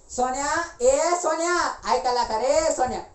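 high, loud holler; calling a child. Acted. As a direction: call the name insistently. Calls out to a child.

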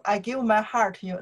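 fast, offhand repeat of the lyric; rushed plain read of the line; quick run-through. Shown as a demonstration. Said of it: "What I say here?".

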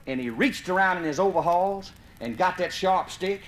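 ordinary delivery

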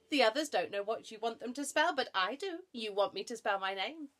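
high, loud, eager chatter; cocky monkey, excited. Acted. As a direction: show off that you know better.

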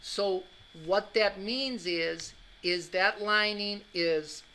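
usual speaking voice

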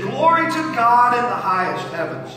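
high, loud, ringing praise; angelic proclamation. Acted. Angels announcing Jesus' birth to shepherds, loud proclamation.

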